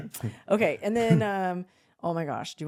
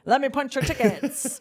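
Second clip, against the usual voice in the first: higher, louder conductor call; mock conductor; 7 semitones higher, 5 dB louder than usual. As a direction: play the train conductor. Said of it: "He kept saying".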